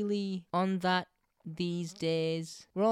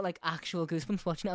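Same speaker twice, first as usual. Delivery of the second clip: ordinary talk, nothing marked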